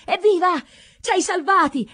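high, loud cries of joy; jubilant cheering. A narrator's reading. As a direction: cheer your rescuer. Peasants cheer their saviour.

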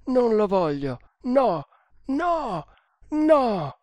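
high, loud anguished refusal rising to a cry; piercing cry. A narrator's reading. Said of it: "emise un acutissimo grido".

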